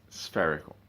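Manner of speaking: usual speaking voice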